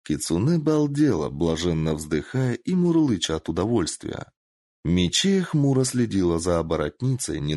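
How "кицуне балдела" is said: usual speaking voice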